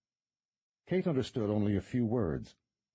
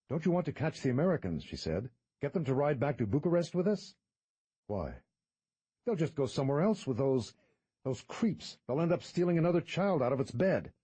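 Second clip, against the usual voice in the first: put-on higher, urgent young woman; Kate's voice; 7 semitones higher than usual. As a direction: press him to go after them.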